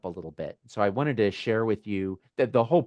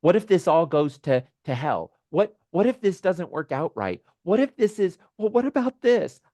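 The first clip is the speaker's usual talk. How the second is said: higher, anxious inner chatter, mildly acted; voice of fear; 7 semitones higher than usual. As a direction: act out fretful what-ifs, pitched up. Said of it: "fear often asks a lot of questions".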